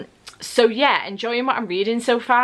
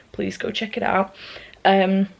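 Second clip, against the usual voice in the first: ordinary talk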